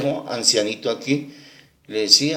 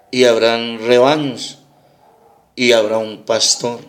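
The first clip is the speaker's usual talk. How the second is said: slow, louder, measured Bible recitation; solemn scripture reading; 3 dB louder, slower than usual. Acted. Pastor reads Jesus' words to the congregation, solemn and slow. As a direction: proclaim the verses slowly and weightily.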